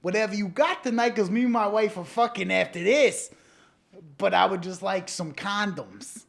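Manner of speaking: mock-earnest restaurant order; insistent customer. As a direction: press the server impatiently, as a bit.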